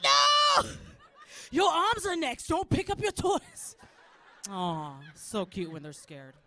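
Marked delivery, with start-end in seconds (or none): 0.0-0.7 high, loud wailed no; horrified child's cry. Acted. Scared son answering his mother, horrified wail. 1.5-3.8 high, loud parental threat; mock threatening shout. Mother scaring child into tidying, mock threatening shout.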